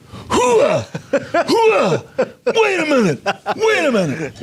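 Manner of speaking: high, loud wild wrestler roar; Bull Curry impression. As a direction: roar like a wild brawler in the ring. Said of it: "If I went like this".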